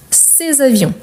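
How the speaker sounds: "ces avions" joined as one word; liaison. Shown as a demonstration. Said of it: "Je fais la liaison".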